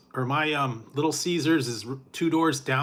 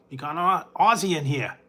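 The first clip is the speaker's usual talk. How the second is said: put-on lightly Aussie-accented, a bit louder; a hint of an Aussie accent; mock Aussie; 3 semitones higher, 5 dB louder than usual. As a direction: announce it like a hammed-up Aussie nature host. Aussie croc-hunter impression.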